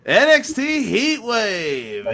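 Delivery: high, drawn-out show-title call; mock announcer hype. Host introducing the first topic to his co-host, mock announcer hype. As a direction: stretch the title out like a ring announcer.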